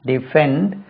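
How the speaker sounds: normal talking voice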